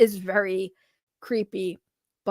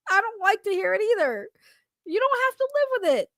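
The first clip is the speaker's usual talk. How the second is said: high-pitched aggrieved whine; whiny protest; 7 semitones higher, faster than usual. Real, not acted. Complaining to a critic about their voice, whiny. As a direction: whine it high, feeling hard done by.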